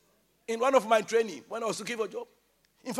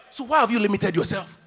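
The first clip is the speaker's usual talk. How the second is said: ordinary talk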